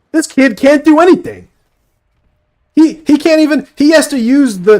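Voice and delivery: loud, high exasperated jeer; loud mocking. Real, not acted. Mocking a teammate who voice-searches, loud and high. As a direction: yell it out in disbelief.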